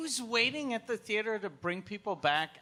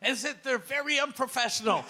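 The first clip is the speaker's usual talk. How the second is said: high, put-on indignant voice; hammed-up grievance, pitched up; mock outrage; 7 semitones higher, 4 dB louder than usual. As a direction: parody an outraged texted complaint. Mock reply to a kind text.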